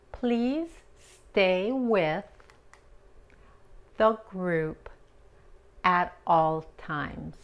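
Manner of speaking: very slow, drawn out "Please stay with the group"; slow clear English model sentence. Shown as a demonstration. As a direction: stretch each word for the class. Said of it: "model phrase repeated".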